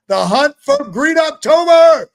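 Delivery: a big drawn-out slogan shout; hyped catchphrase. Acted. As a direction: announce it like a trailer line.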